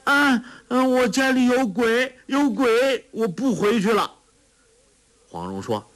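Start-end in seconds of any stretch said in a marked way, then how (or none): 0.0-4.1 put-on much higher, childlike frightened squeal; frightened cry. A narrator's reading. Simple girl terrified of ghosts at home, high frightened squeal. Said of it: "傻姑啊的一声惊道".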